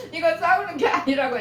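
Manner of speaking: high-pitched mock insistence. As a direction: imitate insisting to a grandchild. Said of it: "왜 소리 지르면서 그래요".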